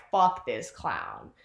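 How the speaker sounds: low, slow mutter; acted grim resolve. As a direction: drop low and drag each word.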